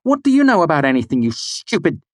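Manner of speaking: fast, high, loud anger; angry outburst. A narrator's reading. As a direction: lash out at her. Kvothe furious at Denna.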